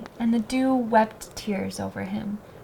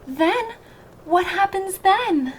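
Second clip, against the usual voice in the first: higher, pressing rise of excitement; the fir tree begging the sparrows, excited and high; eager questions; 9 semitones higher, 4 dB louder than usual. A narrator's reading. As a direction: lean in, desperate to know.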